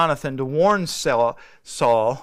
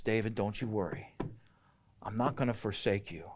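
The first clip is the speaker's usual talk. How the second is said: much softer, hushed comfort; tender reassurance; 7 semitones lower, 13 dB quieter than usual. Retold or imitated. Jonathan reassures David as they part, soft and tender. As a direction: soothe a friend you must send away.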